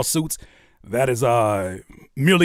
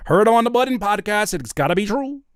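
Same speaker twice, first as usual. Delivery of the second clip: normal talking voice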